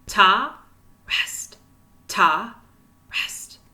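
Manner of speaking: leading the class, ta loud and rest whispered. Shown as a demonstration. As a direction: contrast a voiced ta with a whispered rest. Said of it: "So whisper those rests".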